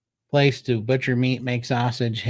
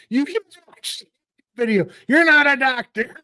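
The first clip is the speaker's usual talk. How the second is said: whiny pleading; 12 semitones higher, 4 dB louder than usual. Retold or imitated.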